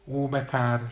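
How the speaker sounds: high, fake wailing sigh; mock lament. A narrator's reading. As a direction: pretend to be sorry. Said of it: "siránkozott az öreg asszony".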